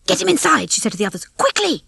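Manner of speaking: high, hurried command; urgent order. A narrator's reading.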